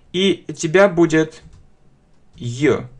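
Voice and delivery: normal talking voice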